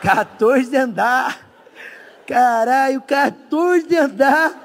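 slow, drawn-out disbelief; mock dismay. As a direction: stretch it out like a stunned climber. Lamento encenado subindo a escada. (espanto arrastado e encenado)

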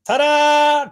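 high, loud, drawn-out mock fanfare; showy reveal. Paul reveals his fake shirt to the chief, triumphant ta-da. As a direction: sing it out like a magician's reveal. Acting Paul's prank reveal.

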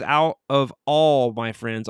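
slow down and lean hard on "all"; stress on ALL. Retold or imitated. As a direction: read "all" as if in capital letters. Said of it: "all caps, all".

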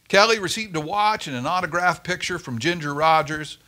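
ordinary delivery, nothing marked

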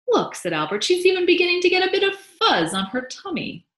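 high, loud, delighted discovery; excited marvelling. A narrator's reading. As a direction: point it out with soaring enthusiasm. Father marvels over the baby.

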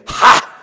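loud, sudden "ha" cry; demon's startled "Ha". Acted. Preacher voicing the demon's expression, loud startled cry. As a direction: burst out with one explosive "ha". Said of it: "there's this little expression here".

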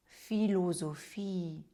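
«філозофії» said slowly, syllable by syllable; slow demo word. Shown as a demonstration. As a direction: spell out «філозофії» slowly for the class. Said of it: "Наприклад".

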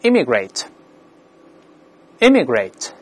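"immigrate" stressed on the first syllable, IM-; front stress. Shown as a demonstration. Teacher reads aloud for the class to repeat, "immigrate" stressed on IM-. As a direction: model "immigrate" slowly with the first syllable strongest. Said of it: "无论英式、美式，重音都在前面".

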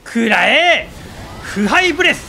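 loud, high shouted attack call; screaming attack name. Pretend. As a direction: yell the move name like an anime hero. Shouting attack names in game.

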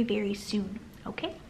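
ordinary delivery

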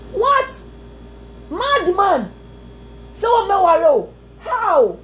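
What said high and stunned; shocked outburst. Real, not acted. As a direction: react with disbelief. Preacher acts shocked reaction.

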